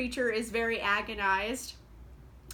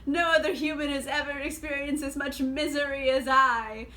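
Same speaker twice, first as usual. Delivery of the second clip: mock a moping hero's lament; overdone tragic sigh of a sad young man; melodramatic whining; 4 dB louder than usual. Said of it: "Victor going".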